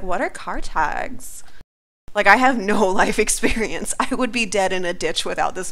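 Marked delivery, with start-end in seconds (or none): 0.0-1.4 clueless question. Retold or imitated. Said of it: "and I was like".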